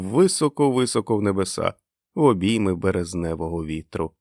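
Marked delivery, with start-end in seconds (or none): none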